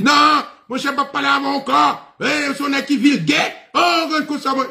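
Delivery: high, loud imitation of a worked-up man; agitated protest. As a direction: refuse indignantly in a squealing mock voice. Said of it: "faut réagir comme ça, agité".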